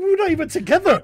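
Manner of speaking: high and loud, fed-up insistence; exasperated protest. Retold or imitated. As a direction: insist hard, half shouting. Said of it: "I was like".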